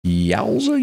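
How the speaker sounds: yowza squealed up high in mock shock; a high yelp of yowza; high surprised yowza. Real, not acted. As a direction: throw the yowza way up in disbelief. Exclaiming at the news.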